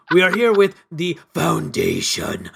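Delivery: put-on Schwarzenegger-style Austrian accent; Arnold accent. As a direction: slip into an Arnold voice by accident. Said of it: "he went straight into Arnold".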